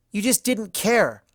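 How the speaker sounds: high, loud, spitting accusation; spat. A narrator's reading. Twilight interrupting her mentor, spitting it out angrily. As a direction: spit the words at her mentor. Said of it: "the mare spat".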